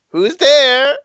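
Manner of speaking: high questioning call. Acted. Gnome popping out of the dome at a stranger, calling out high. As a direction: call out high to someone unseen.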